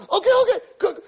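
very high, hammed-up scared pleading; acting terrified to ask, halting then bursting out; mock nervous. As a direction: blurt it out in an exaggerated anxious squeak. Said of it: "'cause I'm so nervous, I'm so scared".

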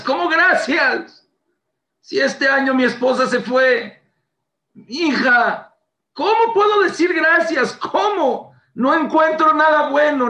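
high, loud protest against giving thanks; indignant outcry. Retold or imitated. Speaker to his wife, protesting in a loud, high voice. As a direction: cry out in disbelief that thanks are due.

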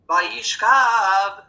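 Vayishkav sung long and loud; slow, weighty trop on Vayishkav; zakef gadol emphasis. Shown as a demonstration. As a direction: stretch the last syllable of Vayishkav. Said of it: "Zakif Gadol".

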